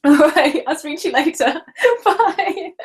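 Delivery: high, giggly goodbye; helpless laughter spilling into the words; laughing. Real, not acted. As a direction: say goodbye through a real fit of giggles. Laughing as she ends the call.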